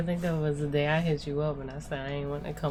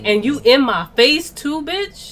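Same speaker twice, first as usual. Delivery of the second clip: indignant challenge; 9 semitones higher, 8 dB louder, slower than usual. Retold or imitated. Retold confrontation with boyfriend.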